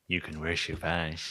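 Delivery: soft chorus of tiny aliens; Toy Story alien voices. Acted. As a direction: coo it like the squeaky toy aliens. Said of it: "little aliens from Toy Story voices".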